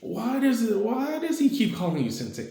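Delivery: put-on high, curious boy; high young voice. Naruto asks why Deku says Sensei, high curious boy voice. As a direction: ask like a nosy kid.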